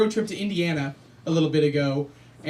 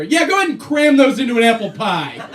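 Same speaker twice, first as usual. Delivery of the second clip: swaggering customer; 3 semitones higher, 6 dB louder than usual. Retold or imitated. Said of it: "I'd be like".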